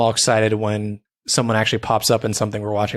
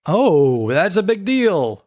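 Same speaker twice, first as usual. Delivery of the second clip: high, drawn-out mock-impressed reaction; mock amazement; 8 semitones higher, 4 dB louder, much slower than usual. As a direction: make a huge deal of a trifle. Hammed-up reaction to trivial fact.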